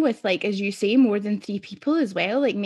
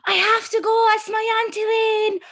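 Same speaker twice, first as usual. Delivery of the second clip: put-on much higher and louder, excited little boy shouting; shouting child; 13 semitones higher, 5 dB louder, slower than usual. Nephew shouting to his friend as he runs off, excited child yell. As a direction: yell it like a four-year-old leaving his pal. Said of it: "all I can hear him shouting is".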